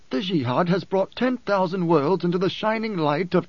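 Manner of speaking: high-pitched devout courtier voice; zealous follower Korba. A narrator's reading. Korba defends the Jihad to his emperor, high devout voice.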